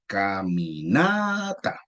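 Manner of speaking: "caminata" slowly spelt out, long rising NA; stress on NA. Shown as a demonstration. Teacher applying the rule, slow "caminata" stressed on NA. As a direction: stretch the NA in "caminata", then drop to TA. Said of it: "la fuerza vaya en la na".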